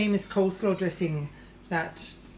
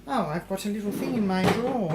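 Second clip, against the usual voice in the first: usual speaking voice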